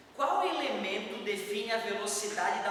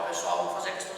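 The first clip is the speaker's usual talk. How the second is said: put-on low-pitched voice, much lower; deep voice; faster than usual. Shown as a demonstration. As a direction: drop your voice into a deep register. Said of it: "se eu falo grave".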